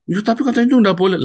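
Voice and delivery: high, loud, fast protest; protesting member. Retold or imitated. Woman protesting to her pastor, high quick objection. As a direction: blurt out the objection in a rush.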